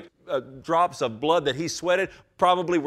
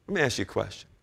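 normal talking voice

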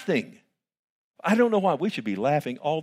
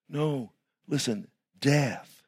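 ordinary delivery

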